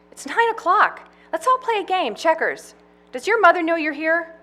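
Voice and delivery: put-on high, eager man-child voice; childlike Farley. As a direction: whine it like an excited kid. Farley voice again.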